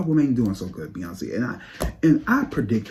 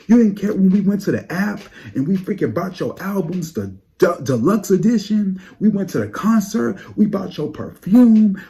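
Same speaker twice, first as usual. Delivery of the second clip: higher, louder ranting tirade; fan outrage; 9 semitones higher, 6 dB louder than usual. Acted. Fan scolding [name] for ignoring them, loud higher rant. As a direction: list every purchase like a grievance.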